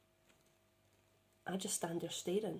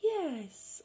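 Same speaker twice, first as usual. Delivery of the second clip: higher, drawn-out womanly yes; human woman's voice; 7 semitones higher than usual. Retold or imitated. The odd human word among robotic talk, higher woman's voice. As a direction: lift the pitch and stretch the single word. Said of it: "would be like a woman saying".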